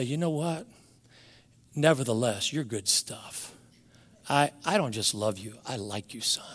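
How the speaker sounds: hushed fatherly reassurance, fading to a whisper; tender, up close. Acted. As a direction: drop low and soft, as if confiding to a son. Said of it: "I wanted him to come down close".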